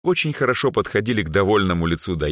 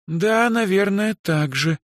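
slow, high, thoughtful drawl; musing girl, drawn out and high; pensive drawl; 14 semitones higher, slower than usual. A narrator's reading. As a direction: stretch the words while thinking. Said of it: "задумчиво протянула Даяна".

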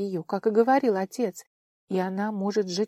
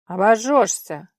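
gruff warning; 2 semitones higher, 9 dB louder, slower than usual. Acted. Stranger warns her sharply.

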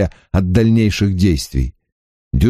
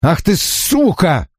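furious; 9 semitones higher, slower than usual. A narrator's reading. Lunging rage.

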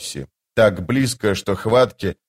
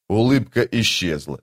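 ordinary delivery, nothing marked

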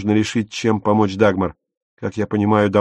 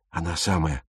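low hissed reply, much softer; 4 semitones lower, 7 dB quieter than usual. A narrator's reading. Пауль шипит брату про Дагмар: тихое шипение. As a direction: hiss it through the teeth. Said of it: "прошипел Пауль".

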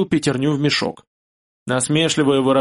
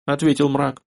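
usual speaking voice

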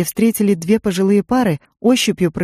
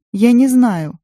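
put-on tearful little girl; 6 semitones higher, 4 dB louder, slower than usual. A narrator's reading. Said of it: "снова всхлипнула".

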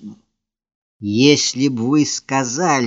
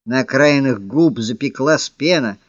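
ordinary delivery, nothing marked

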